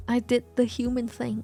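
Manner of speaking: ordinary talk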